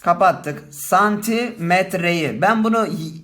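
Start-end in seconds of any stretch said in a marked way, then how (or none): 0.7-2.4 santimetreyi drawn out slowly; slow teacher's dictation of santimetreyi; slow demo. Shown as a demonstration. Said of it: "şuradaki eki nasıl getirdim?".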